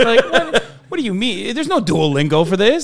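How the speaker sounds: high, loud disbelief; baffled and exasperated; incredulous. Retold or imitated. Mock disbelief at the YouTuber.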